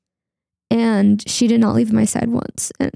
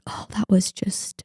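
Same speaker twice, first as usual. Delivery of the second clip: soft, breathy whisper of disbelief; whispered thought; 7 dB quieter, slower than usual. Retold or imitated. Whispering her stunned first thought about that moment. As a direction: hush it like a stunned private thought.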